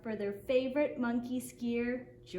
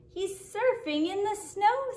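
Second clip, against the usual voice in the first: put-on higher, eager little boy; excited boy; 7 semitones higher, 4 dB louder than usual. A narrator's reading. Boy in the crowd explaining the trick, excited and high. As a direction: announce it gleefully.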